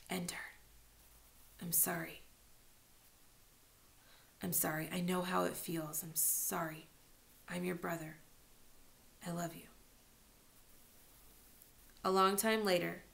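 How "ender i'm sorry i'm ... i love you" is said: much softer, hushed remorse; barely audible, sorry in the dark; whispered apology. Acted. Brother apologizing at night by the bed, whispered remorse. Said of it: "He whispered".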